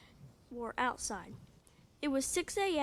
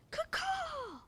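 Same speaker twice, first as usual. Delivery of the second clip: high squawking bird call, kaw kaw; hawk cry. Acted. Child reader squawks the mama hawk's call. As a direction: screech it like a hawk.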